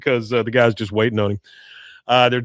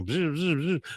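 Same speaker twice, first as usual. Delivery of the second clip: quick mock engine buzz; jet ski buzz; 4 semitones higher, 8 dB quieter than usual.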